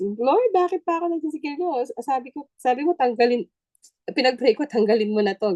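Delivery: high, fast whiny plea to God; complaining prayer. Retold or imitated. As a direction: whine at the Lord like a frustrated new believer. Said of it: "So, nakapag". (mataas at mabilis na reklamong dasal)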